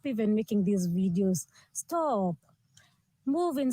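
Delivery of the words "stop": ordinary talk, nothing marked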